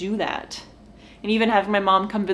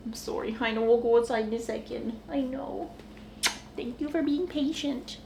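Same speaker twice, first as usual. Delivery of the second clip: much softer, tender apology to the dogs; soothing the dogs; 3 semitones higher, 9 dB quieter than usual. Real, not acted. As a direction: hush and soothe the impatient pups.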